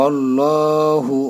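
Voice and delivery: আল্লাহু slow, heavy, one alif long; slow, full আল্লাহু; drawn heavy Allahu. Shown as a demonstration. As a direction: stretch আল্লাহু full-mouthed. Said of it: "এক আলিফ টান দিয়ে".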